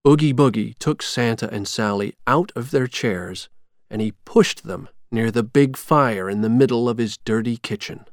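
ordinary delivery, nothing marked

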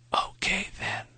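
a faint breathy whisper; a dying man's whispered farewell. A narrator's reading. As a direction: breathe it out barely aloud. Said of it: "he whispered".

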